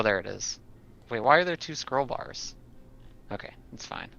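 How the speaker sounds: ordinary talk, nothing marked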